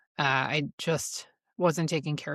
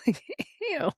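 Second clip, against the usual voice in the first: a much higher, drawn-out ew of disgust; 13 semitones higher than usual. Real, not acted. Reacting to the bragging date, with a high, disgusted ew. Disgust after quoting the man.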